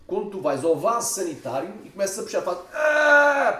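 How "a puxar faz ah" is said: slow, strained push with a groan; straining grunt. Retold or imitated. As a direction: grunt as if pushing hard. Acting out straining on the toilet.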